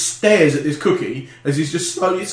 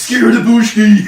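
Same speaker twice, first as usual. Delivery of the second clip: loud high mock gibberish; Swedish Chef accent; 6 semitones higher, 8 dB louder than usual. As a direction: babble it in silly Swedish.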